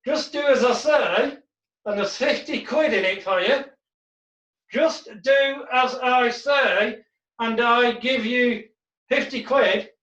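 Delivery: slow, lips barely moving; ventriloquist voice. Shown as a demonstration. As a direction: murmur without moving your lips. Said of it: "but if it was".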